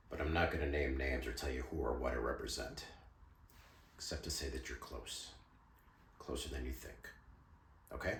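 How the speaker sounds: low, hushed and guarded; guarded confidence. Acted. Informant withholding his name from Mister Garrison, guarded and quiet. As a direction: drop the voice for a veiled disclosure.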